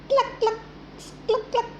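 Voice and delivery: high clipped clucks; teacher shows children a hen's high cluck. Shown as a demonstration. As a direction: cluck like a hen, high and quick. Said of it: "can you guess can hen's sound".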